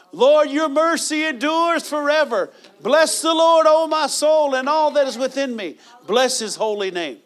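high, loud, slow shout of worship; loud praise. Shown as a demonstration. Preacher showing how to bless the Lord loudly, full voice. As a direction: lift it to the rafters.

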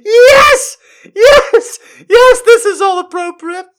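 very high, loud squeaked yeses; hysterical squeal. Real, not acted. Reader insisting it is fine, loud laughing shriek. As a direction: squeal it out at the top of the voice.

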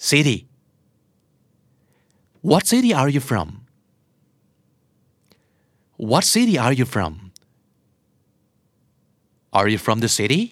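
"City" said like "siddy"; American flap T. Shown as a demonstration. Teacher drilling learners with questions, flap T. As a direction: make the T in "City" a light D. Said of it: "T ที่อยู่กลางคำแล้วกลายเป็นเสียงตัว D".

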